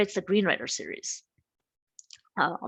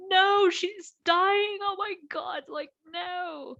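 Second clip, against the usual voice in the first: high, tearful wail of grief, imitating herself; reliving sobbing over a dead character; crying; 11 semitones higher, much slower than usual. Retelling crying over a book's dying character, high tearful wail. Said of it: "I was, like, crying my eyes out".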